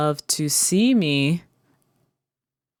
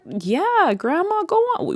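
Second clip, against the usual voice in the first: much higher coaxing voice; much higher voice urging grandma on; encouraging grandchild. Retold or imitated. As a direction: cheer grandma on. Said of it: "What was that conversation like?".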